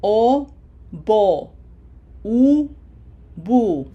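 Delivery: "O, bo, u, bu" as oh-boh, oo-boo; vowel-to-syllable demo. Shown as a demonstration. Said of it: "how to map the sound".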